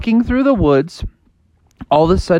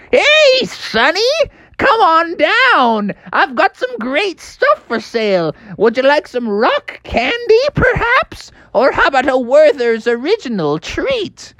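put-on high, creaky old miner; old prospector; 13 semitones higher than usual. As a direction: call out like an old forty-niner. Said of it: "he was an old miner".

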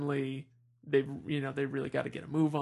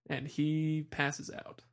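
ordinary talk, nothing marked

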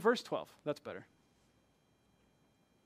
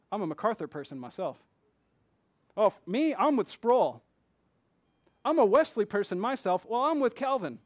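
factional boasts; 3 semitones higher, 6 dB louder than usual. Retold or imitated. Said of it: "this hits more home".

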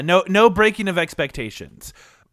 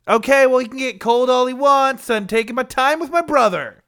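annoyed shout; 6 semitones higher, 3 dB louder than usual. Acted. Annoyed kid yelling back.

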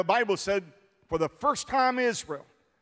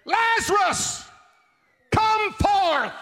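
high, loud, slow commanding shout; Jesus' command; 13 semitones higher, 5 dB louder, slower than usual. Acted. Jesus calls Lazarus from the grave, slow loud shout. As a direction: call the dead man out of the tomb at full voice. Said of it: "And he said".

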